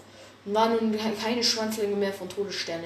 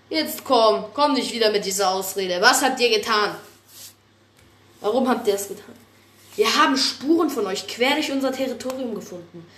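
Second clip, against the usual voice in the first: angry accusation; 3 semitones higher, 7 dB louder than usual. Acted. Heated accusation between leaders.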